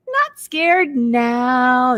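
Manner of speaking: sighing ducks. Acted. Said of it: "ducks sigh and peep".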